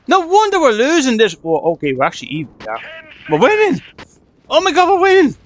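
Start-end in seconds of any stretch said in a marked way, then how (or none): 0.1-1.4 high-pitched frustrated outburst; exasperated exclamation. Real, not acted. Gamer sees why his team is losing, exasperated. 3.3-5.4 high, loud cheer of amazement; thrilled disbelief. Real, not acted. Gamer finds his team suddenly winning, thrilled.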